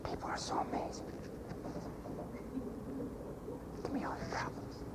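hushed mock whisper; sly whispered aside. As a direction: lean in and whisper it.

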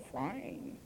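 FINE bitten off the lip, rising at the end; lip-biting F. Shown as a demonstration. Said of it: "grabs into your lower lip".

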